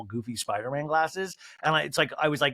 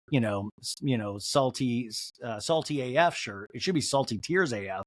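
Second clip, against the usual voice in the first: ordinary talk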